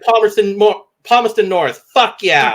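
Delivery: a hint of an ad jingle; retold pitch idea. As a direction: sell it like a slogan. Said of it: "It was like".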